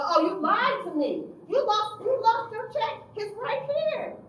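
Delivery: high, loud outraged shout, slowing at the end; furious accusation. Retold or imitated. Counselor waving the cashed check at the youth, loud and outraged. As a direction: hurl it at him. Angry confrontation.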